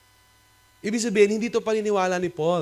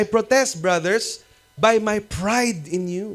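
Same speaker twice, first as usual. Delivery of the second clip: louder, slow emphatic I protest brothers; loud emphatic quote; 3 semitones higher, 6 dB louder, slower than usual. Retold or imitated. Preacher quoting Paul loudly to congregation. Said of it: "Kaya sabi niya".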